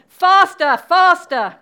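loud, high urgent command; crying out. Acted. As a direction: shout it like a queen driving a runner on. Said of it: "the Queen kept crying".

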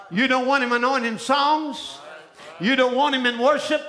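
ordinary talk

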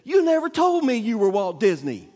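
loud, high mock-indignant child; hammed-up scolding from a small daughter; indignant little girl. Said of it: "hands on my little hips and said".

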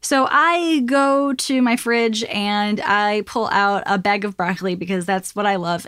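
ordinary talk

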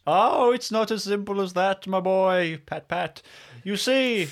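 put-on high, theatrical old eccentric; lilting high voice, stretching the phrases; eccentric old Winston. Gently correcting a guest, high eccentric old-man voice.

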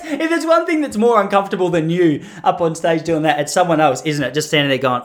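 usual speaking voice

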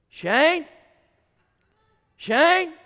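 high, loud calling of the name; mama yelling. Retold or imitated. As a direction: call him from the kitchen, rising. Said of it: "my mom yelling from the kitchen".

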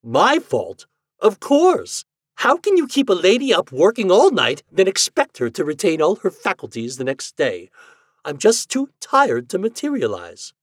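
high, loud indignant quarrelling. Acted. Husband and ghost quarrel over blame, indignant.

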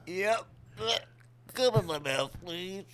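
high frog voice; squeaky pleading frog. Pretend. Still voicing the frog.

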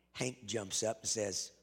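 normal talking voice

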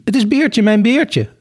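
high, joyful recognition; excited calling. A narrator's reading. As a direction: cry it out high, overcome at finding a friend. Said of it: "riep Sophie". (opgetogen, hoog roepen)

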